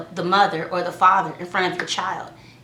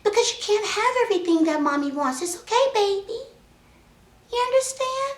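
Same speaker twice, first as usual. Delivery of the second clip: sweet gentle tone; 11 semitones higher than usual. Shown as a demonstration. Mom showing the gentle tone she uses with her daughter, sweet and high. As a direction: coax a toddler tenderly. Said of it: "I can talk like that".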